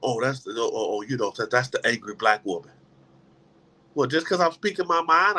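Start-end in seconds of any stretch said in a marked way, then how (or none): 0.0-2.7 lower, sneering office gossip; mocking coworker voice. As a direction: imitate a dismissive colleague labelling her. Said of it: "they'll put a title on you".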